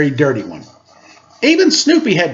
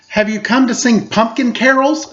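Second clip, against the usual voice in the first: higher, earnest question; Linus asking; 6 semitones higher than usual. Acted. Linus greeting the kids in the patch, a touch higher and hopeful. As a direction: ask hopefully, a little higher. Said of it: "he asked them".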